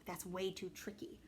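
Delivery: soft secret whisper; whispered to little Mary. Acted. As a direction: hush it so she won't wake.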